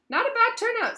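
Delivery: proud. Acted. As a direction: puff up with pride. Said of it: "said Nearly Headless Nick proudly".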